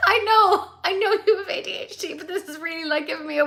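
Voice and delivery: real excitement; excited gush. Real, not acted. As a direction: gush to the chat. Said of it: "I'm so excited about that".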